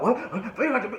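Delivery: high, startled echo question; mock disbelief. Rapper's startled reply, acted.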